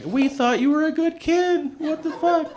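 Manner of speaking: high, mock-tearful parent voice; hammed-up heartbroken parents; disappointed parents. Parents at the hospital bed, mock-tearful.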